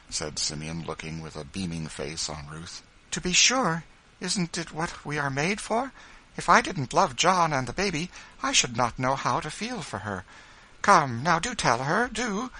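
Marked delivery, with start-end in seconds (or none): 3.1-12.5 high, loud, excited plea; excited little Quaker woman, high-pitched; eager urging. A narrator's reading. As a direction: urge them to tell right away. Ruth keeps pleading eagerly.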